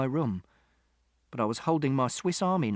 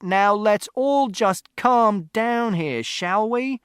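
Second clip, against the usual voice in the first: high, loud, slow and drawn out; peacemaking shout; 9 semitones higher, 7 dB louder, much slower than usual. A narrator's reading. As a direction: shout over the quarrel to calm it. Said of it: "Mister Shears shouted".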